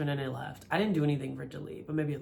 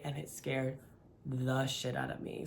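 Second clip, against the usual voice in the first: ordinary talk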